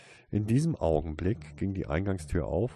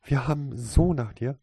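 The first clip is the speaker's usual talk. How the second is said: high, loud effusive welcome; overjoyed, gushing greeting; delighted gushing; 7 semitones higher, 6 dB louder than usual. A narrator's reading. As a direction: rush toward them with raised, eager voice.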